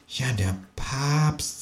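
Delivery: barely above a breath, slowly; quiet. A narrator's reading. Kangaroo agrees about the pope, quiet and slow. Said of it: "sagt das Känguru leise".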